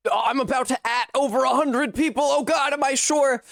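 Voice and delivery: high, alarmed cry of dismay; shrill oh-no panic over a mistake; startled panic. Real, not acted. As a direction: squeak it out in sudden alarm. Panics over pinging everyone.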